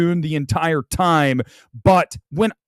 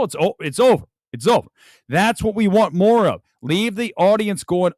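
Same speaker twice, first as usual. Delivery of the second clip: ordinary talk, nothing marked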